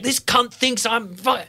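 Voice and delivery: high, loud indignant rant; steaming. Retold or imitated. Dog owner retells fuming about a rude neighbour, steaming. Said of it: "I came back steaming".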